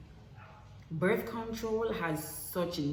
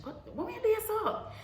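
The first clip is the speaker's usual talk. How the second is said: high, strained cry of despair; despairing inner voice. Retold or imitated. On the pills, asking herself in despair: high strained cry. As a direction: voice the hopeless thought, pitched way up. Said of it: "I'm looking at life just like".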